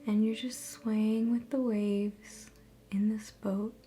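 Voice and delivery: soft, measured meditation voice with long pauses; guided meditation. Acted. Host guiding viewers through a relaxation visualization, guided meditation. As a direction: ease listeners into calm, phrase by phrase.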